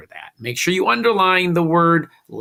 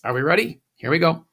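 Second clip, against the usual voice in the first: ordinary talk, nothing marked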